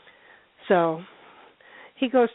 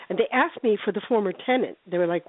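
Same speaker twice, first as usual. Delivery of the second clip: normal talking voice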